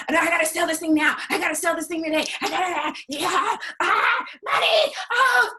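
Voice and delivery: a hammed-up needy seller panicking over a sale; desperate seller. Coach mocking a needy salesperson as frantic and desperate. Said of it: "But when you're like".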